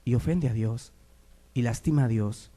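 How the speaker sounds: ordinary talk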